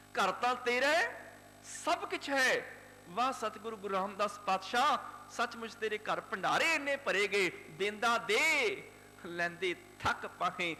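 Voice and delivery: the preacher exalts Guru Ram Das; preaching at full force. Acted. (ਜੋਸ਼ ਨਾਲ ਕਥਾ)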